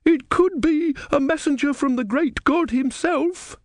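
very high, slow, awed female voice; devout woman. A narrator's reading. Woman in the crowd wondering at the eagle, high devout voice. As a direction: pitch it far up, drawing the words out piously. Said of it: "said the woman".

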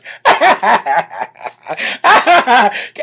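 high mocking cackle imitating Kamala; a hammed-up cackling laugh. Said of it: "cackling Kamala Harris".

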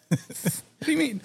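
high confused squeak; mock drunk bewilderment.

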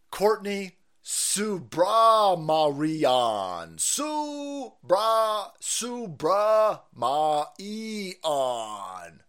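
drawn-out halting mock pronunciation of Subramarian; sounding out Subramarian slowly like a struggling reader; mock slow reading. Mocking the president reading a name off his notes, slow halting drawl.

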